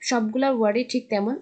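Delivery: normal talking voice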